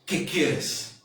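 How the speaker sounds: low, measured voice with long pauses; hushed, solemn preaching. Real, not acted. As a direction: bring it right down and speak intimately to each listener. Hushed, slow preaching after climax.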